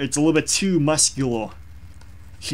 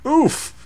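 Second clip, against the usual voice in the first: high yelped game oof; Roblox oof; 12 semitones higher, 4 dB louder than usual. Retold or imitated. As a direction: squeak out the game death sound. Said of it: "You know, like, uh, Roblox".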